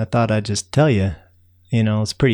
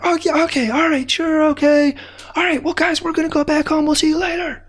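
calling goodbye. Acted. Narrator calling farewell to ants.

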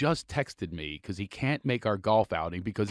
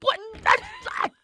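much higher, loud, sputtering astonishment; flabbergasted; 4 dB louder than usual. Real, not acted. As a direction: fumble for words in total shock. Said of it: "I've been flabbergasted before".

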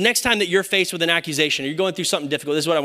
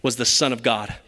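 hushed awe; 7 semitones lower than usual. Retold or imitated. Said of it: "what the guard said".

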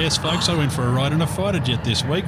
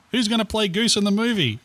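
mock crying; 10 semitones higher than usual.

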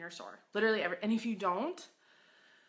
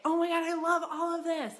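excited gush; 8 semitones higher, 4 dB louder than usual. Pretend. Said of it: "walk into your racks and say".

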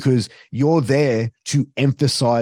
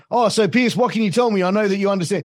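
eager partner commentator; 8 semitones higher than usual. Retold or imitated. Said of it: "And he'd go".

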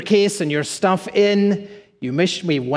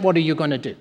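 usual speaking voice

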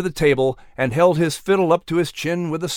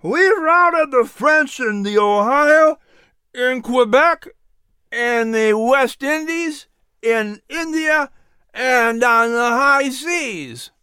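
much higher, slow, every point hammered; emphatic tavern boast; 9 semitones higher, slower than usual. Acted. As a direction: gentleman boasts of victories to the table. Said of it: "as he emphasized every point".

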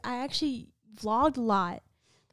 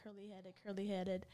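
soft murmur, much quieter; murmuring to herself; 12 dB quieter than usual. Retold or imitated. She retells trying out a channel name, murmuring to herself. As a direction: mutter the name over to yourself. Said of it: "and I'm like".

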